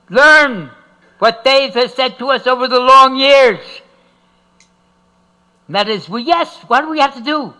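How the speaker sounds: usual speaking voice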